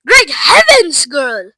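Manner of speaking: high, loud, drawn-out exclamation; screech. A narrator's reading. Mother to her swelling daughter, shocked screech. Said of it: "screeched Missus Beauregard".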